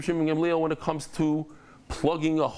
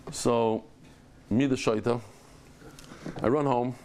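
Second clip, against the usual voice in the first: normal talking voice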